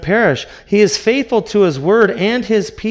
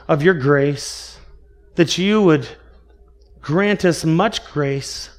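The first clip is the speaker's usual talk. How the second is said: prayer. Acted. Leads a closing prayer.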